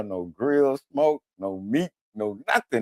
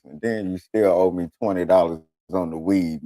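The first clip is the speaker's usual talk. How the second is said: ordinary talk, nothing marked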